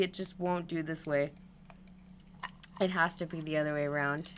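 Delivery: normal talking voice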